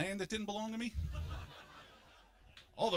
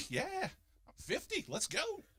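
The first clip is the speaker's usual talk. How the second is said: eager yes; 10 semitones higher, 4 dB quieter, slower than usual. Retold or imitated. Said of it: "come to me and go".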